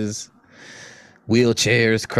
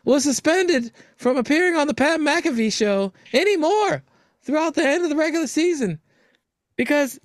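mock news read; 12 semitones higher than usual. Host announcing the Rodgers story to co-hosts, mock anchor.

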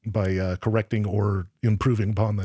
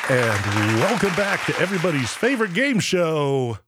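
game show host; 5 semitones higher than usual. Acted.

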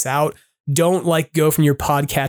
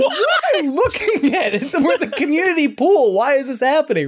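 retold bafflement; 9 semitones higher than usual. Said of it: "I was like".

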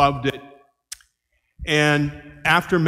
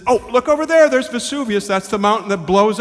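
much higher, louder eager pointing-out; excited tour guide; 6 semitones higher, 5 dB louder than usual. Retold or imitated. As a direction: show off the sight like a thrilled local guide. Salvatore excitedly showing Vesuvius.

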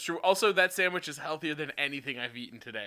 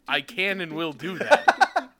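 slow, laughing promise; 3 semitones higher, 6 dB louder, slower than usual. Real, not acted. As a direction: laugh through it. Joking promise to get drunk.

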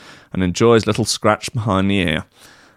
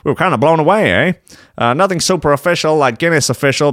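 higher, badly faked Canadian accent; failed Canadian accent; 5 semitones higher, faster than usual. Podcast host quoting Sean Rock, failed Canadian accent. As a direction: have a clumsy go at a Canadian accent. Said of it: "that's not a Canadian accent".

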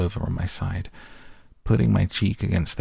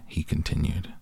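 ordinary delivery, nothing marked

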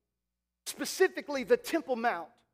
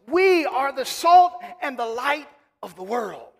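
loud, emphatic preacher's proclamation; raised, declaring pulpit voice; full-force preaching; 2 semitones higher, 6 dB louder than usual. Real, not acted. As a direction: proclaim scripture to the congregation, landing hard on key words.

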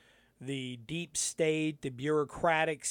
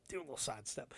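whispered aside, much softer; 6 semitones lower, 15 dB quieter, much faster than usual. Shown as a demonstration. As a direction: whisper it to yourself.

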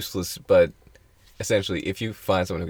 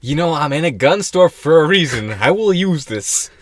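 higher, louder, slow mock threat; mock menacing threat; 9 semitones higher, 7 dB louder, slower than usual. Joking threat to a chatty store clerk, higher and louder. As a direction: ham up a customer threatening pushy staff.

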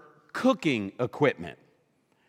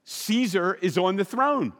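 mock cheery herald; 7 semitones higher than usual. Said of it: "the heralds went out and said".

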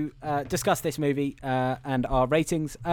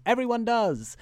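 higher-pitched passing interjection; Alan Arkin; 6 semitones higher than usual. Retold or imitated. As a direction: toss it in like a lightly mimicked passer-by. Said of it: "Alan Arkin walks by and goes".

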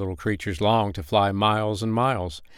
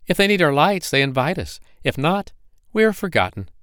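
higher and louder, wounded pride; hurt, angry firefly; 8 semitones higher, 3 dB louder than usual. Acted. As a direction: complain sharply, pitched high. Another firefly complains.

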